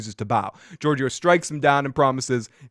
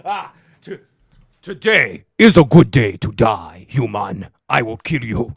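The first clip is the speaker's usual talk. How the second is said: loud, lower growl of a hammed-up Klingon warrior; mock Klingon; 5 semitones lower, 7 dB louder than usual. Host imitates Tyler raging in Klingon mode, hammed-up. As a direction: ham up the Klingon war cry. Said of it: "you know the whole Klingon thing".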